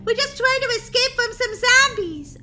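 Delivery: high, loud, fast scolding; exasperated. Acted. Sister scolding Molly about her packing, exasperated. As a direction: snap at her to get serious.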